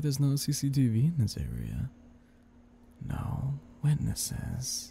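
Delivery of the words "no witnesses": slow menacing whisper; sinister whisper. Acted. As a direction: breathe it slowly, sinister. Devil hints at crime.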